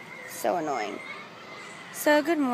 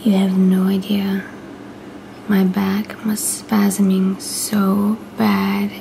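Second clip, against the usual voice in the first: whispering; 7 dB louder than usual. Real, not acted. Whispers to camera throughout.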